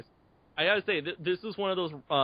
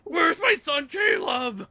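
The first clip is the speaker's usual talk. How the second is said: high-pitched cry for a missing son; frantic movie father; 9 semitones higher, slower than usual. Acted. Acting out a father searching for his son, frantic shouting. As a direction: wail it out in mock desperation.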